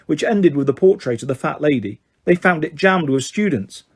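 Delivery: plain reading voice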